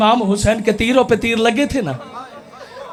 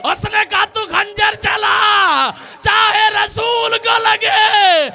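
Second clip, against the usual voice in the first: preaching at full force; 9 semitones higher than usual. Acted. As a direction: drive the grief to its peak. Climax of martyrdom narration. (पूरे ज़ोर से ऊँची, धीमी मातमी सदा)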